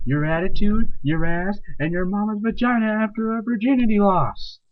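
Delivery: usual speaking voice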